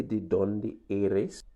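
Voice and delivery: De dónde eres? drawn out slowly, stress on dónde; slow Spanish model. Shown as a demonstration. Teacher asking the class, slow clear Spanish model. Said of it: "If I say".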